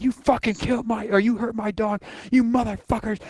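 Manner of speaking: high-pitched mock fury; mock angry rant. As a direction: rage at the enemies who hurt the dog. Retold rage at enemies.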